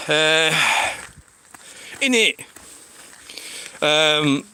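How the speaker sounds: ordinary delivery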